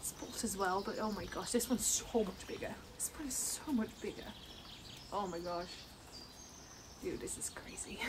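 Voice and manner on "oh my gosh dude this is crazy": breathless whisper of disbelief; hushed real awe; awed whisper. Real, not acted. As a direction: whisper it, too amazed to speak up. Whispering in amazement at plush.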